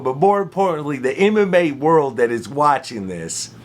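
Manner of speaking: usual speaking voice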